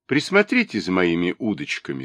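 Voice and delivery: ordinary delivery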